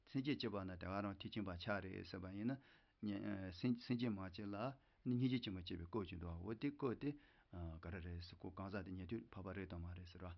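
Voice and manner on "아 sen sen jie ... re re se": ordinary talk, nothing marked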